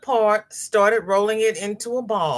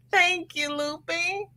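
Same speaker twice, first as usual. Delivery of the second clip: thanks with a laugh, pitched up; 12 semitones higher, 4 dB quieter, slower than usual. Real, not acted. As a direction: giggle through it in a higher voice.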